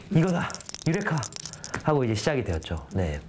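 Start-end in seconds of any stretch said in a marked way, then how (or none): 0.1-1.2 higher, mildly excited exclamation; retold eureka moment. Alone in a cafe catching the idea, retold eureka. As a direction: re-enact a sudden discovery.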